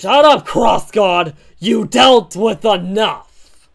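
loud, emphatic acted outburst; angry shout. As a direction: yell it, stretching the last words. Hero yells at villain.

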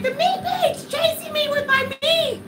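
high terrified yelling; being chased. Retold or imitated. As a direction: shriek like something is chasing you. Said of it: "And I'm like".

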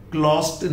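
क्लोज्ड said as "klost"; क्लोज्ड with ट instead of ड; wrong t ending. Shown as a demonstration. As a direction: harden the end of क्लोज्ड into a t. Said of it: "नहीं होगा".

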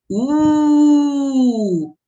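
high "U" with the lips rounded and nearly closed; vowel U. Shown as a demonstration. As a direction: purse the lips and sing out "U". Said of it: "Fale o som".

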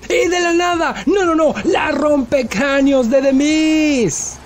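narración aguda, alargando las palabras del remate; grito de narrador. Acted. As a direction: canta el remate como clímax de la pelea. Comentario del remate de la lucha. (grito emocionado de comentarista)

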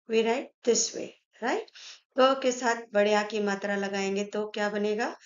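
ordinary delivery

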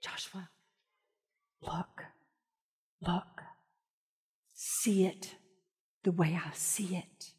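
God reassuring Joshua quietly; soft whisper. Acted. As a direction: breathe it gently. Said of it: "He's going".

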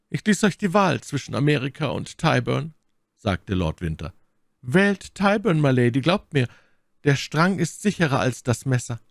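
a stern lord questioning his officer; suspicious baron. A narrator's reading. Lord Winter voiced by narrator. (Lord Winter fragt seinen Offizier misstrauisch, höher)

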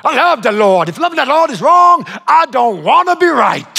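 mock-fervent movie line; hammed-up preacher. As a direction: overplay it like a fiery film preacher. Hammed-up film line, laughs it off.

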